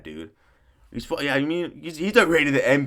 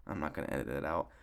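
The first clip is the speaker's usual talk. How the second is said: quick quiet aside; 9 semitones lower, 13 dB quieter, faster than usual. Real, not acted. Aside after the edit remark.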